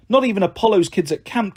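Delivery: ordinary delivery, nothing marked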